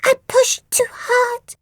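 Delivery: high, slow tearful apology; little monster crying an apology. A narrator's reading. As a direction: sob it out sorry. Phoebe apologising, crying heard.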